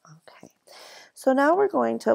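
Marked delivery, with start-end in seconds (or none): 0.1-0.5 whispered okay. Real, not acted.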